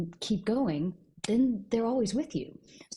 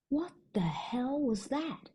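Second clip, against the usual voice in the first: slow, whispered, baffled Wonder Woman; puzzled whisper; 6 semitones higher, 3 dB quieter, slower than usual. Acted. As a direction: whisper it slowly, bewildered. Wonder Woman in told joke.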